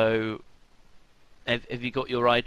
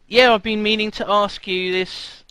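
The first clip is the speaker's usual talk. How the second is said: hammed-up Australian accent; bad Australian accent; 6 semitones higher, 5 dB louder than usual. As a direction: ham up a terrible Australian accent. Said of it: "I don't know what part of Australian that is".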